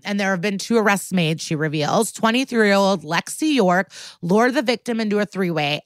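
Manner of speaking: normal talking voice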